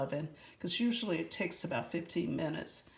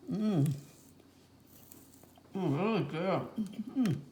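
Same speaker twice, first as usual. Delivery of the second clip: talking through a mouthful of cookie; words round a bite of food; mouth full. Real, not acted. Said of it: "I'm talking with my mouth full of cookies".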